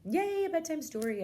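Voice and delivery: high, loud squeal of delight; child overjoyed at bedtime; excited child. Retold or imitated. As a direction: cheer like a thrilled little girl. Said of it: "Evelyn's all excited".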